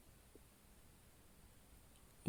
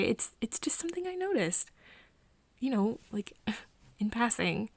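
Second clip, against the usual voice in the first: flustered denial; 3 semitones higher, 4 dB louder than usual. Acted. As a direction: caught out and hurriedly defending yourself. Flustered denial of staring at jeans.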